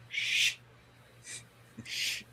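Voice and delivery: soft drawn-out shush. Acted. Refusing to talk to approaching people, shushing. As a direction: shush instead of answering. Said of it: "like that".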